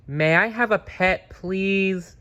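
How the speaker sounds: put-on high little-boy voice asking politely; a small child begging sweetly for a pet; child pleading. A narrator's reading. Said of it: "Franklin pleads with his parents".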